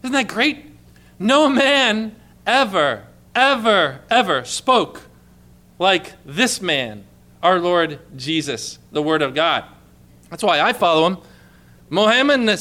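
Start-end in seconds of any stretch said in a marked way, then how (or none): 1.2-7.0 slow, each ever hit hard, building; emphatic repetition. Real, not acted. Preacher to congregation, slow emphatic ever ever ever. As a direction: lean on every ever.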